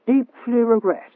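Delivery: ordinary delivery, nothing marked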